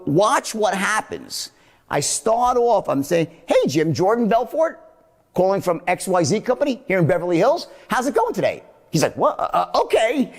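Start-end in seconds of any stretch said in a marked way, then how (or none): 3.5-7.7 uptones on Jim, Belfort and Hills; phrase "Jordan Belfort" like a question, lifted; declarative as a question. Shown as a demonstration. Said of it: "first three uptones that form these declarative as a question". 7.9-8.6 GOING lifted high; higher "How's it going today?", keen to know; caring uptone greeting. Shown as a demonstration. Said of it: "I hit them with this". 9.2-10.3 high, hesitant okay; confused reply. Retold or imitated. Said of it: "they're so confused".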